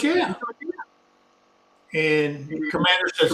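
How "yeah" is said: high-pitched quoted "yeah"; imitated high, quick confirmation; retold reply. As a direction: toss it up high as someone else's answer. Commander's answer to shocked staff.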